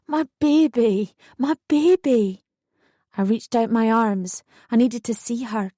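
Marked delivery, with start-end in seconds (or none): 0.1-2.4 high, slow tearful cry of relief. A narrator's reading. New mother crying over her newborn, tearful. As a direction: sob it out with joy. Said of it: "I burst into tears".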